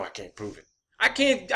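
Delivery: usual speaking voice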